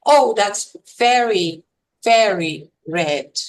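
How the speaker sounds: VERY, VERY said slowly as two words, each falling, with a break between; separated repetition. Shown as a demonstration. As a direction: set each "very" apart, as if a comma divides them. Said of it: "Your intonation would separate the two".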